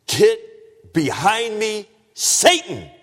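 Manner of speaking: high, loud, slow preacher's rebuke; severe rebuke. Retold or imitated. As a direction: hurl it out as a stern command, word by word. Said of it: "what a severe rebuke".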